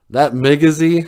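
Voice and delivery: cocky, dismissive storybook fox; scoffing. A narrator's reading. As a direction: scoff at a rival's name.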